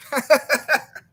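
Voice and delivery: quick high giggle; short laugh. Real, not acted. Vlogger laughing at Lucas's excuse, giggling.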